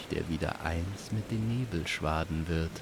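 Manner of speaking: plain reading voice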